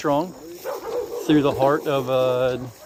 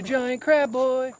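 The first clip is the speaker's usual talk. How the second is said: drawn out high and slow; high slow sing-song; 9 semitones higher, much slower than usual. Shown as a demonstration. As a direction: croon it like a pet name.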